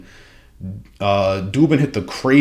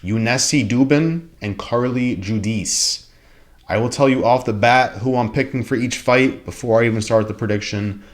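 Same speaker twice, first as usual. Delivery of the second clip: usual speaking voice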